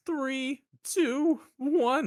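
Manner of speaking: high, frightened announcer countdown; scared countdown. Retold or imitated. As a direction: count down like someone's about to shoot you. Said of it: "The way he just goes like, like he's scared".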